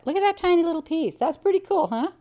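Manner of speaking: high, squeaky little voice; tiny-voiced delight, pitched very high; tickled, cutesy delight. Real, not acted. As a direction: go way up high, marveling at something tiny. Showing off tiny scrap.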